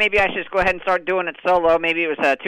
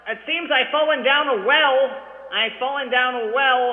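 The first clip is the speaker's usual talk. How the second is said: down a well; 5 semitones higher, slower than usual. Acted. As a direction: call up from the bottom of a well. Calling from bottom of well.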